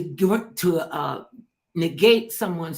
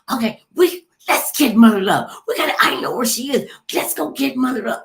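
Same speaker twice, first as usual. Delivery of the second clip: higher, louder mock excitement; eager recruiters; 6 semitones higher, 6 dB louder than usual. Recruiters hunting down their next star, mock excited. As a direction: play recruiters rallying loudly to fetch her.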